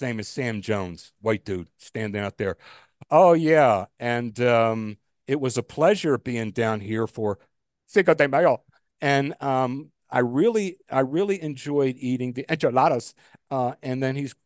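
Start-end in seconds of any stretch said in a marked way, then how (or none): 3.1-13.1 put-on a reporter lightly slipping into a Spanish accent on Cinco de Mayo; hammed-up Latin accent, louder on the Spanish words; mock Spanish-accent reporter. Reporter on TV lightly putting on a Spanish accent. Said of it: "they break into another accent for about two seconds".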